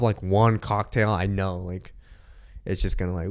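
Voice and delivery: ordinary talk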